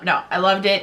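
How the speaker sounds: ordinary talk, nothing marked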